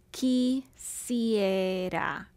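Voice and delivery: Quisiera drawn out, qui-sie-ra; very slow syllables. Shown as a demonstration. Teacher dividing quisiera slowly. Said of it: "We have quisiera".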